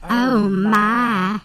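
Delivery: put-on country accent. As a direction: swoon in a Southern accent. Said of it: "sweet country accent".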